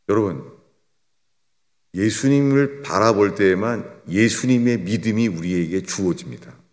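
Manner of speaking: ordinary delivery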